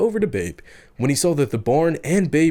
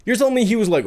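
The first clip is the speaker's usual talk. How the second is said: normal talking voice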